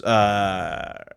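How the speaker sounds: ordinary talk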